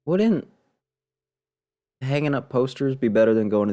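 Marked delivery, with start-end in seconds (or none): none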